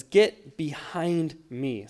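slow, weighty reading of the verse; quoted scripture. Retold or imitated. Pastor reading the verse slowly to the congregation. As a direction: let each word land slowly. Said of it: "the scripture says".

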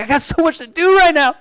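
high, fast panicked thought; stressed pastor racing the clock in his head; frantic inner voice. Retold or imitated. Pastor panicking inside before service, high and rushed. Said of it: "in my head, I'm like".

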